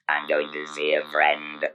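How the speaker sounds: usual speaking voice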